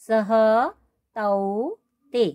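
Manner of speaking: slow, drawn-out model pronunciation; deliberate word-by-word chant; slow recitation drill. Shown as a demonstration. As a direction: stretch each word out for students to memorise. Models forms to memorise in order.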